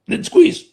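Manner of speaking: quick gasping sip of air on top, then squeeze; sharp inhale. Shown as a demonstration. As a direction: show the extra gulp of air at the top of the breath. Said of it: "A little more at the top".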